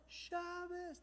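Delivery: imitated crowd cheer. Whole bar echoing the singer, soft high mock cheer.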